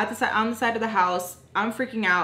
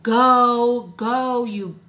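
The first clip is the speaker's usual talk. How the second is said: louder rowdy "go, go"; loud jeering; 3 semitones higher, 7 dB louder, much slower than usual. Retold or imitated. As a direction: heckle her away. Boys yelling at her.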